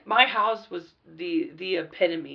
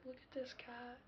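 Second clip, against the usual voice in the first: much softer, higher cooing voice; 7 semitones higher, 26 dB quieter than usual. Retold or imitated. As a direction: coo it over the cat. Infatuated kid petting a cat.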